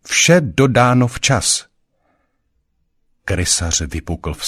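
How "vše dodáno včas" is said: high, loud insistence; defiant. A narrator's reading. Councillor resists the mocking ratcatcher, loudly defiant. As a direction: insist on it with raised pitch and force. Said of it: "pokusil se Konšel o odpor".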